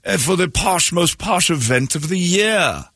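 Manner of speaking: slow mock posh British announcer; imitating a posh British ad voice; British accent. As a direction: stretch it out in a hammed-up British accent. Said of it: "he does this British accent".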